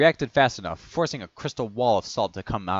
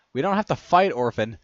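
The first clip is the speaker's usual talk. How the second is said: high, loud start, easing off; raised-voice appeal; pleading yell; 5 semitones higher, 6 dB louder than usual. A narrator's reading. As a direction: call out to stop a fight. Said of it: "Cody yell out".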